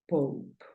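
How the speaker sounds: pope with an open O, final E silent. Shown as a demonstration. Teacher repeats the model word for the learner, open O. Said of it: "Sonido abierto de la O".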